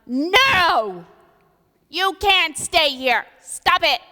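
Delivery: high, loud stern command; booming God. Retold or imitated. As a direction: shut it down like an exasperated father. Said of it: "God's like".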